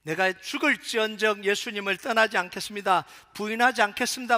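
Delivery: emphatic oath, projected hard; fervent vow. Acted. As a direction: declare it forcefully, with full conviction. Acts Peter's earlier vow.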